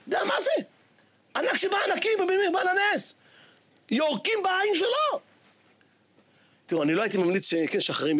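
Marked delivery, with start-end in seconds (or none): 0.1-5.2 outraged exclaiming. Real, not acted. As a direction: marvel at the outrage. Preacher's outrage.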